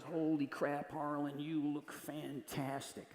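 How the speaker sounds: lazy rock-star voice. Retold or imitated. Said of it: "This is my p-, [name], by the way".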